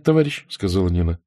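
ordinary delivery, nothing marked

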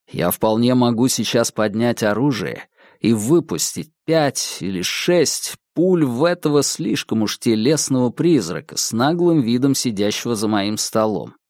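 usual speaking voice

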